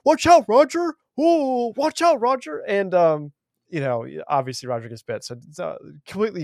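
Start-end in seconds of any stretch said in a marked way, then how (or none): 0.1-2.6 high-pitched, hammed-up calling out; mock warning call. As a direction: imitate a useless lookout hollering a warning. Useless pilot calling warnings to Roger.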